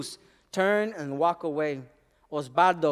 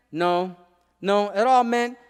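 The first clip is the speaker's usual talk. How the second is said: ordinary delivery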